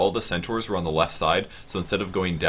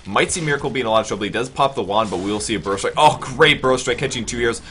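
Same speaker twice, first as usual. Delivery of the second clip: louder hype commentary; excited call; 4 semitones higher, 7 dB louder than usual. Real, not acted. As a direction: call the fight with energy. Fight breaks out.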